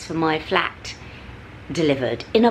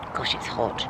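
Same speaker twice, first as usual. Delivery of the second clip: murmured under the breath, worn out; breathy murmur; 8 semitones lower, 8 dB quieter than usual. Real, not acted. To viewers, wilting in the heat, murmured.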